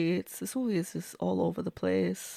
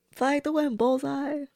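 higher, hammed-up Disney cheer; Disney-ish sing-song; 6 semitones higher than usual. Shown as a demonstration. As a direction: lift it up like a cartoon heroine's send-off. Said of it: "I don't mean to get all Disney-ish".

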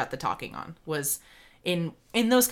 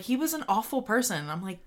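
ordinary talk

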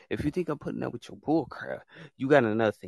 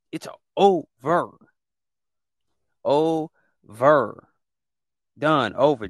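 shouted finality; 3 semitones higher, 7 dB louder, much slower than usual. Real, not acted. Host to trolls, loud final shouts. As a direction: end it with a shout, no arguing. Host ranting, hammering the point.

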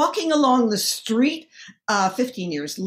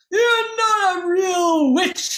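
high, drawn-out mock bellow; imitated heckler's bellow; 10 semitones higher, 3 dB louder, slower than usual. Drunk heckler to the witch in the tree, bellowing. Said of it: "he bellowed up at me".

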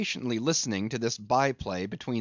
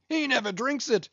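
very high, excited teasing captain; jovial interrupting captain; 11 semitones higher than usual. A narrator's reading.

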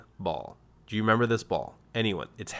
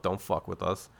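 ordinary talk

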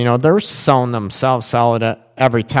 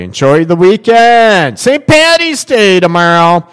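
loud, high cheer to the listeners; excited sign-off; 6 semitones higher, 6 dB louder than usual. Real, not acted. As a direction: call it out, big and festive. Host signing off with a cheer.

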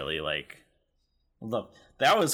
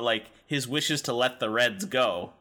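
ordinary talk

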